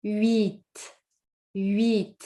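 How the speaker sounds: huit as wit, U then E; huit, H silent. Shown as a demonstration. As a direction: huit with a forced little smile on the I. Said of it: "force your smile a little bit".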